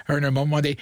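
normal talking voice

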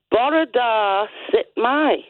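put-on Welsh greeting in a lilting Welsh accent. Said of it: "lovely Welsh accent there".

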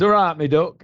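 East Midlands "All right, me duck", a rising friendly greeting; East Midlands accent. Retold or imitated. [name] repeats the East Midlands greeting he learned from a co-star. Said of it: "that area of the Midlands".

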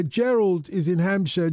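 normal talking voice

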